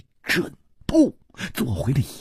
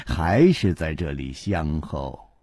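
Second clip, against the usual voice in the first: plain reading voice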